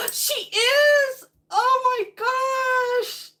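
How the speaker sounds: high, loud, crying out in delight; gasp of joyful surprise. Real, not acted. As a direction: gasp and shout for joy at the news. Hears friend's pregnancy news.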